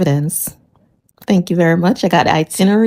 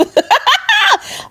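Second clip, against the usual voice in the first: high cackle. Real, not acted. High far laugh.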